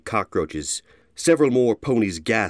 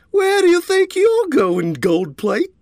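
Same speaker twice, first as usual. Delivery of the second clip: high, loud taunting thug; brutish bully; 8 dB louder than usual. A narrator's reading. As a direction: shove him around with words. Bullies confront him.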